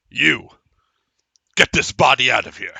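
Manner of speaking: loud barked command; commanding order. Acted. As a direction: order it from a towering giant. Inquisitor ordering player.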